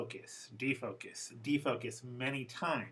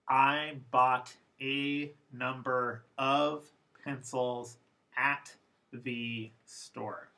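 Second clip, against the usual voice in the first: slow, full formal English; much slower than usual. Shown as a demonstration. Teacher reading the formal version slowly. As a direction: pronounce every word fully and slowly. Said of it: "First formal".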